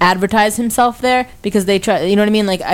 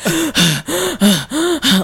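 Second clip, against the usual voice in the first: imitated out-of-breath panting; 6 semitones higher than usual. Imitating winded shirtless kid fanning himself, exaggerated panting. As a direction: pant as if winded and overheated. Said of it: "He's, like, out of breath".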